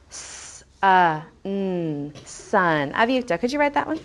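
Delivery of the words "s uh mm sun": "sun" split into s, uh, n, then blended; sounding out. Shown as a demonstration. Teacher helping children spell, sounding out "sun". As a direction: stretch each sound of "sun" separately, then say it whole. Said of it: "help me write those two words".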